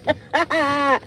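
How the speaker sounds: real burst of cackling laughter; uncontrolled laugh after a punchline; helpless laughter. Real, not acted. Cracking up at the quote.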